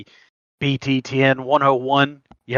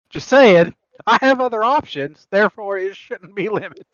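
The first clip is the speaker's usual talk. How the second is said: trying not to laugh; 8 semitones higher, 4 dB louder than usual. Real, not acted. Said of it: "I can't even say it with a straight face".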